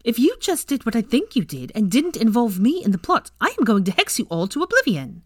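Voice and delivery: high, indignant threat; glaring threat. A narrator's reading. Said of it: "glaring at them all".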